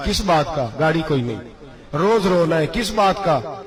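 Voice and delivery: ordinary talk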